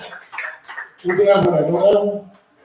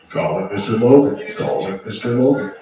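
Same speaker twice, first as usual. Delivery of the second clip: lower, hammed-up paging voice; mock announcer; 8 semitones lower than usual. Host pages the missing comedian, mock announcer low. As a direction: page him like a lightly voiced announcer.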